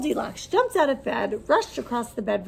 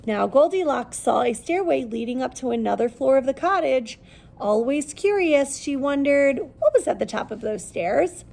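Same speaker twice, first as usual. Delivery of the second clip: usual speaking voice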